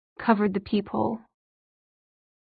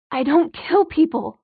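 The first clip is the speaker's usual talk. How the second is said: protesting shout; 6 semitones higher, 4 dB louder than usual. A narrator's reading. Son denies being murderer.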